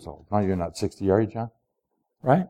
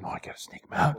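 secretive whisper; 12 dB quieter, faster than usual. Acted. Friend plotting to sneak Paul out, whispering. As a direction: breathe it out like a conspirator.

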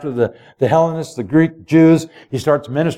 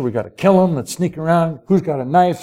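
ordinary talk

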